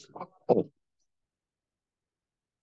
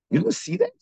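incredulous question. Retold or imitated. Rabbi asks his student in disbelief.

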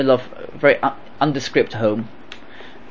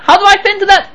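high, rhetorical question; questioning, much higher; 5 dB louder, faster than usual. Shown as a demonstration. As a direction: lift the whole question high.

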